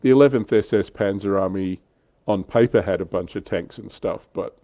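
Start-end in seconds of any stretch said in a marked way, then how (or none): none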